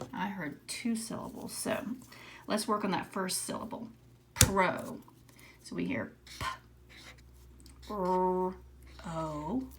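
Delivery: ordinary talk, nothing marked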